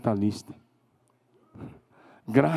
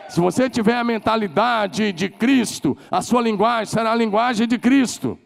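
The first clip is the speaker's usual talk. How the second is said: preaching louder and higher on Christ's mind; rising preach; 8 semitones higher, 4 dB louder than usual. Real, not acted. Preacher lifting his congregation, louder and higher. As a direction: lift the voice, build to Christ. Preacher raising voice on key point.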